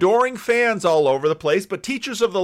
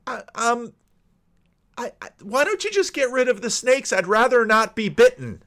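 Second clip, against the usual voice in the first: higher-pitched, stammering mock protest; hesitant objection; 6 semitones higher than usual. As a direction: stumble into it, then object in a higher voice. Voicing most people's reluctant objection.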